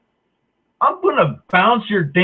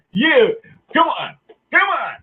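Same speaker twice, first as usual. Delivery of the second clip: taunting woman; 8 semitones higher than usual. Retold or imitated.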